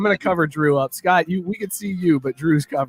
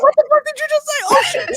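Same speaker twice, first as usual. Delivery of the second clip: much higher, stunned squeak of disbelief; shocked disbelief. Retold or imitated. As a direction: sound floored by what you just heard. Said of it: "And you're like".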